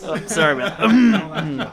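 laughing apology. Real, not acted. Laughing at the accent mix-up.

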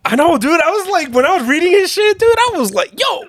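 high, excited words mixed with laughter; laughing. Real, not acted. Telling a friend about reading the comic, high excited laughter.

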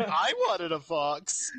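mock-upset complaint. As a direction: drag it out like a jealous kid, laughing. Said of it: "He's like".

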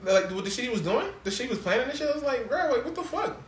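high-pitched, exasperated disbelief; incredulous. Retold or imitated. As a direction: build to a loud, high what-on-earth protest. Exasperated disbelief at absurd plot.

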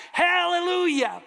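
very high, loud held hallelujah; shouted hallelujah. Retold or imitated. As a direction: roar it back as a congregation. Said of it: "they yelled and they shouted back".

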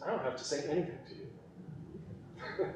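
breathy laughing whisper; hushed amused whisper as God; God's dismissive aside. Retold or imitated. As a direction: whisper it like a private joke. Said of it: "what God essentially tells Job is".

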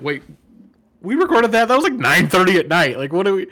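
high, loud incredulous rant; hammed-up panic at a friend; mock shock. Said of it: "I'm like".

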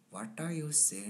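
imitated Indian accent. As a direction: copy an Indian man's rhythms. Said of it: "I was just copying his rhythms".

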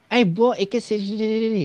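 a hint of a campy sassy voice; lightly camp it up, beckoning; sassy drag queen. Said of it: "bahasa bancinya keluar dah". (gaya banci yang centil, sedikit dibuat-buat)

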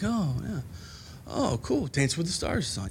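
a hint of a husband ignoring his wife; mock tuned-out husband.

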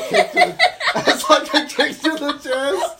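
giggling through the words; laughing. Real, not acted. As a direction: laugh while you say it. Laughing while telling story.

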